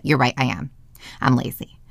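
low, soft self-blame; resigned agreement. Acted. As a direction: give in quietly, voice sinking. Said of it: "You would immediately internalize that".

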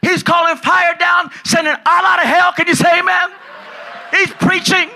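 normal talking voice

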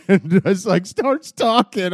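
high, loud words breaking into laughter; laughing. Real, not acted. Friend cracking up telling a show scene, laughing loud. Laughing while retelling the demon.

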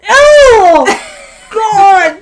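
high, loud cry of disgust; disgusted shout. Real, not acted. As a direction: shriek in revulsion. Disgust at gross photo.

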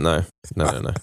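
ordinary talk

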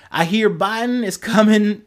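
Utah twang; hog speak. Retold or imitated. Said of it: "this is my hog speak".

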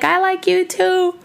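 high, slow swooning voice; mock lovestruck gush; gushing new crush. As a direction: swoon over someone you just met. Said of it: "then they're like".

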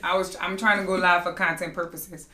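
ordinary talk, nothing marked

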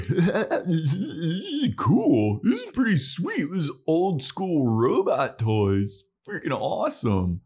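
high, drawn-out mock excitement; hammed-up boy thrilled by toys; gushing kid. Zach gushing over toys.

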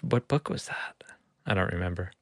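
soft unvoiced mutter while thinking; whispering to himself. Real, not acted. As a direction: whisper it to yourself, trying to remember. Mumbling to himself, trying to recall.

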